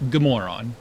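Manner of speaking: "Good moron" run together fast, with "Good" stressed; said quickly. Shown as a demonstration. Showing the fast, natural Swedish greeting. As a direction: rattle off "Good moron" in one quick breath. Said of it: "you say it really fast".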